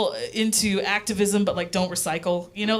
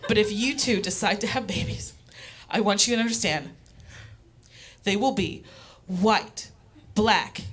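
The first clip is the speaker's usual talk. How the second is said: mom's serious lecture; 4 dB quieter than usual. Retold or imitated. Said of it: "this is a real reenactment of her reaction".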